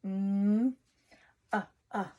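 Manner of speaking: mm held, then uh repeated; sounding out. Shown as a demonstration. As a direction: sound out each piece slowly. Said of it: "think about all of the sounds".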